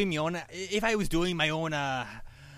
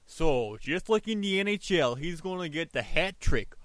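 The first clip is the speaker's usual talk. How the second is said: Canadian hockey guy. Pretend. Pitching Tom Laidlaw to friends in a mock Canadian accent. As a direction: talk up your buddy Tom in a Canadian accent. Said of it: "I gotta get in my Canadian mode here".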